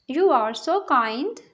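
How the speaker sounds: slow, clear repeat for learners; slow teacher repeat. Shown as a demonstration. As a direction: draw each word out for the class. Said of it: "teacher repeats sentence slowly".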